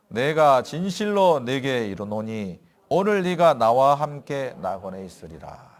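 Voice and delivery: slow, solemn Bible reading; scripture reading. Acted. Pastor reading Jude to the church, solemn and slow. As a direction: read the verse slowly and gravely. Reads Jesus' words aloud.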